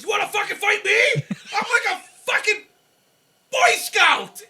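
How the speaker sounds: high, loud, blustering shout; drunk giant's challenge. Retold or imitated. Retelling the wedding brawler challenging him, loud drunken shout. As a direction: bellow it like a drunk giant picking a fight. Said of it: "he looked at me and goes".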